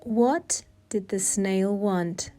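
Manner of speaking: usual speaking voice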